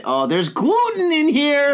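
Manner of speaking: hammed-up alarm, pitched high and drawn out; mock horror. Podcast host pretends the beer's gluten is a disaster, mock horror. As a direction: cry out in fake dismay, stretching the words. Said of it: "No, I'm joking".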